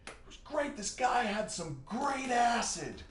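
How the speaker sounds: giddy stoned singer falling out of the van. Retold or imitated. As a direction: drag it out like a tripping bandmate. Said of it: "and he's like".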